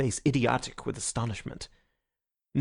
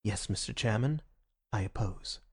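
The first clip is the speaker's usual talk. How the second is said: softer, low-key objection; small quiet voice; 3 semitones lower, 6 dB quieter than usual. Acted. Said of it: "in a small and quiet voice".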